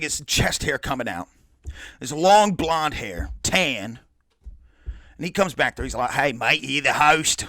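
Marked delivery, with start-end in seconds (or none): 6.1-7.5 terrible mock Australian; parody Australian accent. Musician greeting the open mic host, parody Australian accent. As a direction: put on a bad, broad Australian accent. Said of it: "my Australian accent's terrible".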